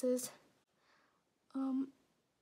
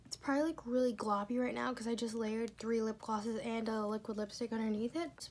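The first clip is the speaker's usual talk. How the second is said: ordinary talk, nothing marked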